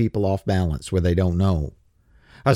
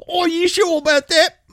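taunting challenge; 5 dB louder than usual. Pretend.

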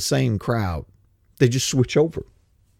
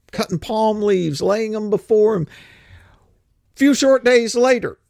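preaching at full force; 8 semitones higher than usual. Acted. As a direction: preach it out to the congregation, rising.

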